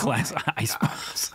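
slow, whispered punchline; whispered joke. Real, not acted. Laughing host whispers a joke to the panel. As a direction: whisper it slowly as a sly aside. Joking aside after ice pops.

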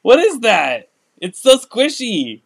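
delighted by the squishy toy; wide-eyed wonder; excited. Real, not acted. Reacting to squishy toy unboxing.

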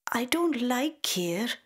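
put-on high, slow, pouty little girl voice; sulky child. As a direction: turn up your nose like a fussy five-year-old. Child voice refusing a dish.

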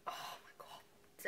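breathless whispered gasp. Real, not acted. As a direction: whisper it under your breath. Lemon juice everywhere.

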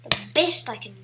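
usual speaking voice

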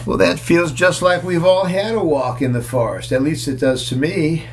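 ordinary delivery, nothing marked

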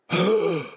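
sharp loud high gasp; shocked gasp. A narrator's reading. Mother hearing the crocodile question, a loud shocked gasp. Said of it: "His mother went".

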